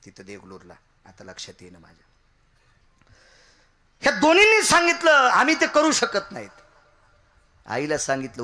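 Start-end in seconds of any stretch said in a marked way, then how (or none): 4.0-6.6 high, loud kirtan cry to the gods; preaching at full force. Acted. Preacher crying out to the gods in kirtan. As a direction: belt it out like a temple preacher.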